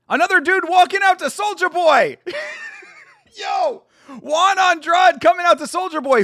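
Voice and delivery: loud, high, hyped shouting at the walkout; excited shout. Real, not acted. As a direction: yell it out like a fan losing it. Hyped by [name] walkout.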